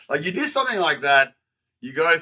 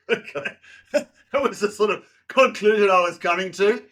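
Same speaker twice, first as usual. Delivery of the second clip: a laugh running through the words, pitched up; 7 semitones higher than usual. Real, not acted. Guest laughing at his own verdict on himself, laughing. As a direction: chuckle while owning up to it.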